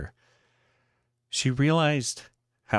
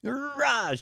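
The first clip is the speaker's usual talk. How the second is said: high charging battle cry. Acted. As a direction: charge at the machine with a high yell. Charging the vending machine.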